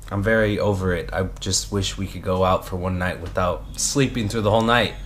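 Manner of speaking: usual speaking voice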